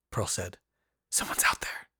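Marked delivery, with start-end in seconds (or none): 1.1-1.9 hushed, trembling whisper; frightened whisper. A narrator's reading. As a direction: whisper it, scared of being heard. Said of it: "Her voice quivered".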